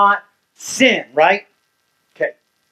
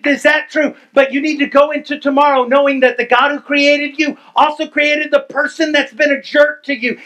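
higher and louder, driving the sermon home; preaching at full force; 10 semitones higher, 5 dB louder than usual. Acted. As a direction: preach it with rising fire.

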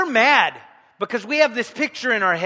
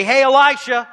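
loud call to Elisha, a bit higher; calling at the door; 4 semitones higher, 8 dB louder than usual. Pretend.

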